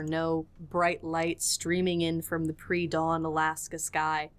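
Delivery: ordinary delivery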